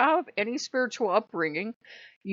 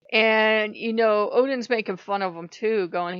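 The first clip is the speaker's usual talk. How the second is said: ordinary delivery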